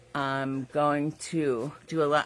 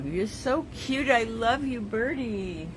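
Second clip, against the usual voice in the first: cooing to birdie; 7 semitones higher than usual. Real, not acted. Cooing at bird painting.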